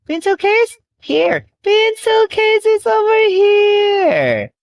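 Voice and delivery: excited. Acted. Excited high discovery.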